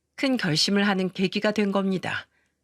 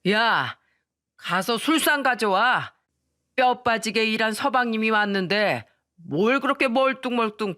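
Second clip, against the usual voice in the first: slow, dragged-out demands, slightly raised; acted drunken bluster, mildly shouted; drunken yelling father; 3 semitones higher than usual. A narrator's reading. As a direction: order your wife about as a drunk husband. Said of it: "고래고래 소리를 지르면서 소리쳤습니다".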